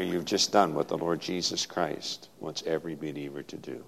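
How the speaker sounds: normal talking voice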